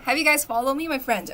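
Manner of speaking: usual speaking voice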